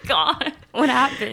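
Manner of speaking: loud surprised laugh; shocked. Real, not acted. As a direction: gasp at the news. Shocked reaction to story.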